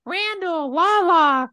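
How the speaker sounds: put-on high, sing-song drunk girls calling his name; drunk women cooing. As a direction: coo his name like tipsy fans. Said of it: "the women were like".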